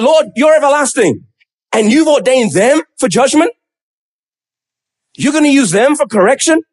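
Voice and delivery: acted incredulity, lifting into questions; incredulous. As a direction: question God in baffled disbelief. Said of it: "You can almost hear the incredulity in his voice".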